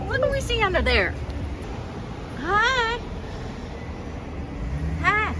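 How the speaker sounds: high, fast sing-song greeting; cute cooing. Real, not acted. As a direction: coo at it like a pet. Cooing at something cute inside.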